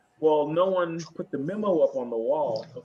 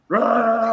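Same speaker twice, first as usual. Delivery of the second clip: short raised roar imitating a drill sergeant; higher, louder mock yell; 7 semitones higher, 5 dB louder than usual. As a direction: imitate her yelling with a quick roar. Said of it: "as she yelled at me".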